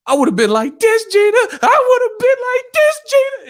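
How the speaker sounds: excited squawk with punchy beats; Mama Payne. Retold or imitated. Mama Payne throwing combos at Gina, high falsetto. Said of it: "he was playing Mama Payne".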